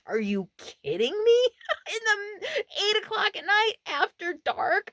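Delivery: high, loud disbelief with a laugh; incredulous outburst. Retold or imitated. Camper reacting to late-night intruders, incredulous outburst. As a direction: protest it as if it's absurd.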